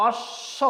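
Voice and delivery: "অশ্ব" split on a long hissed sh, b left silent. Shown as a demonstration. Teacher showing learners the silent ব, অশ্ব said as "osh-sho". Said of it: "বয়ের উচ্চারণ আসছে না".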